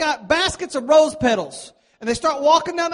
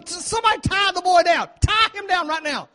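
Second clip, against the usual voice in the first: urgent order; 7 semitones higher than usual. Retold or imitated. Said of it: "I'm having to tell my groomsmen".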